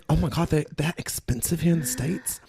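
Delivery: posh British astonishment; posh British accent. Acted. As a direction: play a well-heeled Brit abroad. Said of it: "it's a very posh accent".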